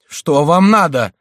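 high, loud, slow nervous question; trembling voice. A narrator's reading. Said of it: "спросил приор дрожащим голосом". (дрожащим голосом)

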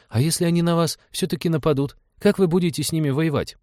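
put-on high-pitched child questioning; insistent little boy. A narrator's reading. Boy asks how they'd fight pirates, insistent high child voice. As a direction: press for an answer like a small boy.

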